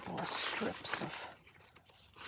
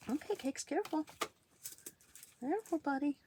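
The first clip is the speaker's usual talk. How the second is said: sharp playground warning; 7 semitones higher than usual. Real, not acted. Warning cat jumping up.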